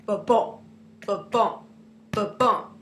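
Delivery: thump it out like a beating heart; teacher imitating a heartbeat to show the iamb; heartbeat imitation. Shown as a demonstration. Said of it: "sound of a beating heart".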